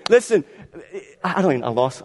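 ordinary talk, nothing marked

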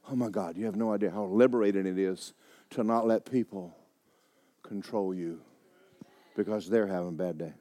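low, soft preacher voice; hushed sermon; intimate preaching. Acted. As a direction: draw them in quietly.

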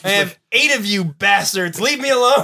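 hammed-up fed-up yell, climbing in pitch; exasperated mother. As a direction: blow up at the pestering kids. Voices mother fed up with kids.

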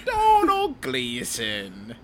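slow, hammed-up Irish name; fake Irish accent. [name] reading a name in his mock Irish accent. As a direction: roll the name out like a brogue.

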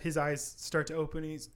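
ordinary talk, nothing marked

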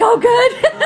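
high, loud hammed-up relief; exaggerated relief. Bartender learning she is sober now, loud high mock relief. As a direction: blurt out relief in a squeal.

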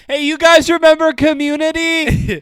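high, loud, slow mock shout. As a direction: yell it to the crowd. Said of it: "He just kept shouting".

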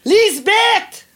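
high, insistent holler; calling out loudly. Acted. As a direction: call impatiently to someone offstage. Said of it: "Qu'est-ce que t'as à crier de même?". (appel fort, aigu et insistant)

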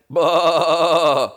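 loud single bah from the father sheep; gruff dad sheep. Acted. Dad sheep calling across the paddock, loud bah. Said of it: "next thing you hear from the other side".

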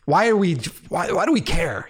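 mildly heated, retold exasperation; exasperated. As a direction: raise the pitch in a retold tantrum. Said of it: "I get so mad about it".

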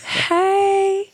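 put-on very high, giddy voice for the boyfriend; imitating excited fiancé. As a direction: play him bursting with joy. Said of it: "he's like".

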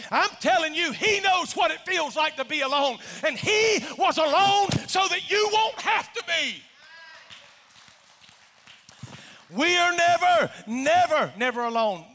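shouted, high preacher's climax, slowing on never; preaching climax. Acted. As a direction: hammer it home to the congregation.

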